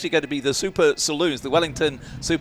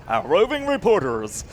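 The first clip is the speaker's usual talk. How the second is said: put-on old-time radio announcer voice; hammed-up vintage newsreel delivery; 1950s newsreel announcer; 4 semitones higher than usual. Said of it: "That was a nineteen fifties kind of a thing".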